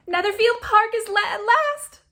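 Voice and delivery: very high, loud giggly squeal; excited interruption. A narrator's reading. Younger sister interrupting with news, giggly squeal. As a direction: squeal it out, cutting her off. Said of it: "interrupted the second".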